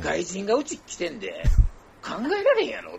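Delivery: 関西弁で大げさに; Kansai exaggeration. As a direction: play it up like a neighbour boasting. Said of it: "とか言うてな".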